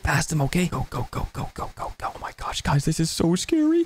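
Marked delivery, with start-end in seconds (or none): 0.7-2.6 urgent hushed whisper, go go go. Acted. Child sneaking past sleeping dad, urgent whisper. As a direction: hiss it so dad can't hear.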